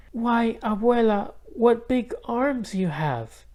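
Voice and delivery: put-on slow down and wonder like a child; high, slow, wide-eyed girl; wondering little girl. A narrator's reading. Isabel questioning the disguised wolf.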